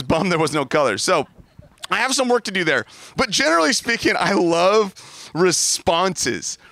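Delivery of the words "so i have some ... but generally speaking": ordinary talk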